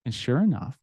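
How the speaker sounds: normal talking voice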